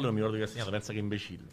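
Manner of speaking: ordinary delivery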